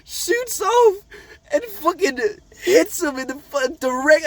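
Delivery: high, loud laughing squeal; barely getting it out through laughter; cracking up mid-story; laughing while telling. Real, not acted. Laughing while recounting the meteor hit.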